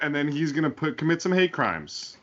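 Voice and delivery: ordinary talk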